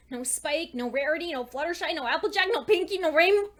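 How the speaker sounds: building from worry to near-shout as the list goes on; rising panic. Acted. She names every missing friend, panic building to a shout. Listing her vanished friends.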